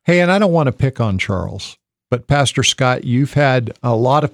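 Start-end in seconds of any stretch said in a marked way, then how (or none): none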